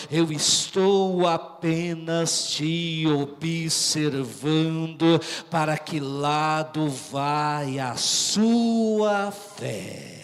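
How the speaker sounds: low, very slow and drawn out; calm divine reassurance. Acted. Preacher voices Jesus to a weary believer, slow and low. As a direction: stretch each word, sinking at the end.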